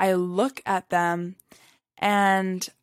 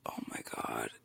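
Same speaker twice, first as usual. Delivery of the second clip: breathy whispered awe, much softer; 18 dB quieter than usual. Retold or imitated. Telling of the moment before speaking up, a whispered gasp of awe. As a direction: barely breathe the words out. Inner reaction retold in whisper.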